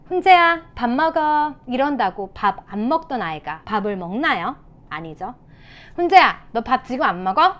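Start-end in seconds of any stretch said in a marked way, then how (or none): 0.1-1.5 high, loud mock command; ordering parent. Shown as a demonstration. Parent to a child not eating, acted order. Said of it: "아이가 밥을 안 먹어요". 5.9-7.5 angry parent. Shown as a demonstration. Parent to a child not eating, acted anger. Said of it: "이런 식으로 화낸다고 해서".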